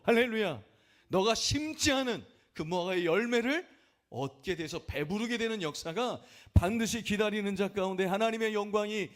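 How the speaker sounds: loud, high, climbing preacher's cry; rising shout of blessing; preaching at full force. Acted. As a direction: pour it out like a revival preacher.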